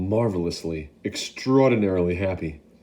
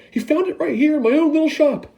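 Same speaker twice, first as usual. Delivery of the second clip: much higher and louder, frantic; yelling; 5 dB louder than usual. Acted. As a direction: shout orders to the crowd. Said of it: "he yelled".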